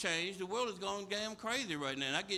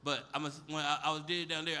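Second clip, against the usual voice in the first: usual speaking voice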